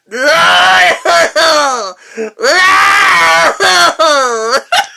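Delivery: badly faked wailing sob, high and loud; a hammed-up unconvincing howl; fake crying. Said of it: "least convincing sounding crying of all time".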